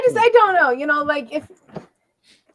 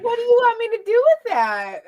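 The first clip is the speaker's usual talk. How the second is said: baffled laughing; 10 semitones higher, 4 dB louder than usual. Pretend. Imagined baffled reply to guy.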